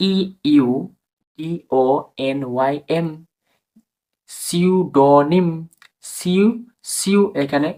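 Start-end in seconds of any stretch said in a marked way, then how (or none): 4.3-5.5 সিউডোনিম stretched syllable by syllable as "siu-do-nim"; slow, higher সিউডোনিম, starting on S with no P; silent P, "siu". Shown as a demonstration. Teacher demonstrates spelling to sound, slow সিউডোনিম. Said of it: "এখানে উচ্চারণ হবে ইউ".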